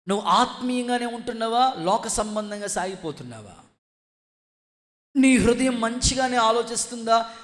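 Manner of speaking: high, loud challenging questions; forceful preaching. Real, not acted. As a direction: confront the congregation, rising to a loud command. (ఆవేశంగా గట్టిగా ప్రసంగం)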